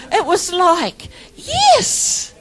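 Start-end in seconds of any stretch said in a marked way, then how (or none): 1.3-2.3 excited. Real, not acted. Exulting about joyful prayer.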